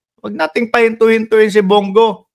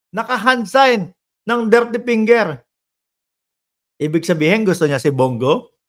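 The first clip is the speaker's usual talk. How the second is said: usual speaking voice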